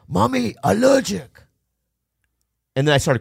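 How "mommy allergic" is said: high, loud, slow broken-English dad voice; hammed-up curt father with an accent; imitating dad. Voicing his dad in story.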